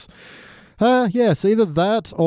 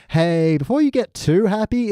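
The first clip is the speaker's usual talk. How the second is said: slow, drawn-out opening in a higher voice; Littlefinger impression; 5 semitones higher than usual. Littlefinger interrupting Sansa's happiness, mock-friendly. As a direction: sidle up to spoil the happy moment. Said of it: "Littlefinger rocks up to him and goes".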